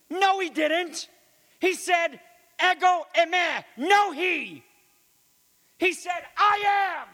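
high, fired-up correction of the translation; excited correction. Real, not acted. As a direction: leap up high to set the record straight. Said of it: "Not, "I am he".

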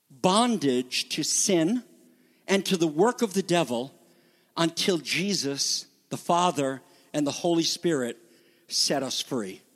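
ordinary talk, nothing marked

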